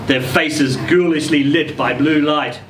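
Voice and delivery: usual speaking voice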